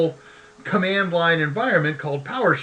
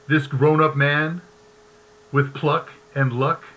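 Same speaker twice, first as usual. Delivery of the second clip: poem reading; 4 semitones lower than usual. Acted. Reads an Ogden Nash poem.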